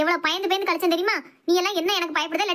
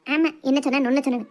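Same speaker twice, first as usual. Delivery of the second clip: mocking retort; 5 semitones lower than usual. Mother-in-law mocks son's question.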